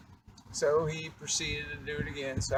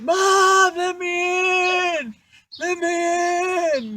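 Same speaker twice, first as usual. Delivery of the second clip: very high, very slow, loud desperate wail; desperate shouting; 12 semitones higher, 9 dB louder, much slower than usual. Retold or imitated. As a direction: beg through the door, shrill and drawn out. Bobby begging mom.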